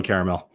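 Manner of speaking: "caramel" said as CARE-uh-mel; three-syllable caramel. Shown as a demonstration. Streamer siding with the poll, "caramel" as CARE-uh-mel. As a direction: keep the middle vowel in "caramel": CARE-uh-mel. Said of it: "three, three syllables".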